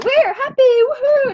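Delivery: high, loud joyful whoop; cheering whoop. Real, not acted. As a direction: throw it up like a cheerleader. Cheer climax of the chant.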